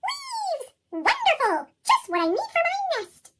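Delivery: squeaky high delighted squirrel voice; a squirrel thrilled at free nest stuff. Acted. Squirrel grabbing leaves for its nest, high and delighted. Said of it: "said a squirrel".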